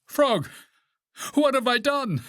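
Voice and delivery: high, alarmed call; high-pitched pleading outcry; distressed cry. A narrator's reading. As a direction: cry out in dismay to a lost friend. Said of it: "cried Toad".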